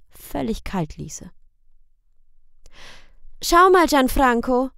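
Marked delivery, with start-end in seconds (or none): 3.4-4.7 put-on high, bright girl calling out; high calling girl's voice. A narrator's reading. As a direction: call the boy over like an eager girl. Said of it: "sagte das Mädchen".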